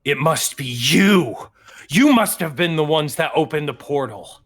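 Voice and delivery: loud, emphatic accusation; accusing. Acted. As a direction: round on them and pin the blame. Father turns on the party accusingly.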